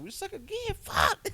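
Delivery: high-pitched mock scuba voice; put-on squeaky scuba voice, dropping on the last word. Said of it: "scuba voice on at the end".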